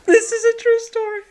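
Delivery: high laughing through the words; can barely get it out for laughing; giggling disbelief. Real, not acted. Laughing at friend's crash story.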